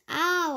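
a mild yelped Ow; child reading a pained Ow; scream. A narrator's reading. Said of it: "I screamed".

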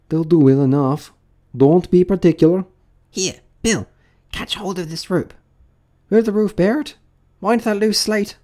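high character voices calling out; busy workmen. Acted. Workmen call to one another.

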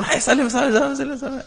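put-on high, laughing call to a child; high laughing parent voice. Imagined parent urges child to greet grandparent, high and laughing.

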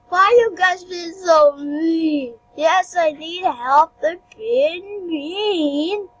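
slow, drawn-out play whine; mock whining. Picked-on game character complaining to the guys, mock whining. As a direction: stretch the words out like a hammed-up complaint.

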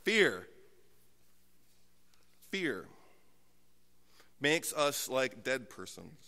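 low, soft and grave; solemn preaching. Acted. Pastor to his congregation, soft grave sermon refrain. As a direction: let each word sink in.

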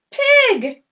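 high loud pig; big reveal; excited reveal. Pretend. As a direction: announce the pig with delight. Revealing animal.

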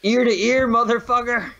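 high, loud mock screech of rage; berserk rage. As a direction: shriek the threat in mock fury.

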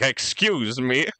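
drawn-out, indignant comeback; mock offense. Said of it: "We're like".

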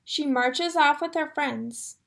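ordinary delivery

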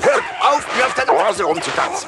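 high, loud furious yelling; angry shout. Acted. Yelling at the dancers on his nose, furious. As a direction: scold them at the top of your voice.

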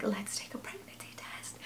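whispered with no voice at all; hushed whisper. Retold or imitated. Whispering to sister-in-law at seven in the morning, hushed. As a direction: whisper it very softly, like a secret. Secret early-morning test, partner asleep.

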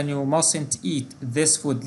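very slow, word by word warning; teacher reading a model prohibition slowly; slow example read. Shown as a demonstration. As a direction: spell out the warning for students. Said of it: "أو مثلًا".

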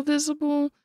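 visible lifted up high; high emphatic repeat. Shown as a demonstration. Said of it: "quite visible".

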